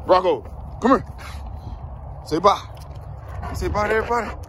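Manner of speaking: high sing-song call to the dog; dog-talk call. Real, not acted. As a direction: coax the puppy over sweetly. Saying bye to the dog.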